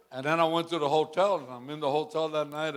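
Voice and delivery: usual speaking voice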